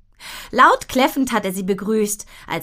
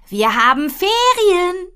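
excited; 10 semitones higher, 6 dB louder, slower than usual. Acted. Girl announces holidays.

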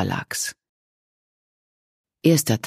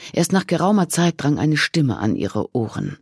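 ordinary delivery

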